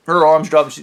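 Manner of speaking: normal talking voice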